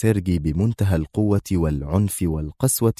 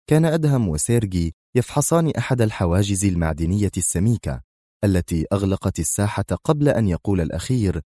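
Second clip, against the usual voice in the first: plain reading voice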